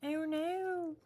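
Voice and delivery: high startled oh no; surprised. Real, not acted. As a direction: caught off guard by a mistake. Something goes wrong in crafting.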